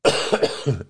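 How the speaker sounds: short bout of coughing; real cough. Real, not acted. Recovering from man flu on the show, real coughing. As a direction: cough through the tail of an illness. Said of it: "As he coughs".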